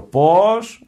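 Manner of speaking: high, loud mock-deaf "Πώς"; loud "what?". Deaf monk asking the visitor to repeat, loud and high. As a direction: call out as if you heard nothing. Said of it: "Τρίτη φορά, ακόμα πιο δυνατά".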